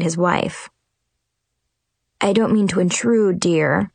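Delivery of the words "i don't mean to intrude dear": usual speaking voice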